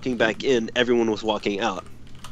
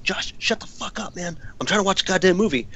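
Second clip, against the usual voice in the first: hushed start, then higher and hurried; retold exasperated scolding; 6 semitones higher than usual. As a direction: imitate scolding a friend during a film. Scolding friend mid-movie, retold.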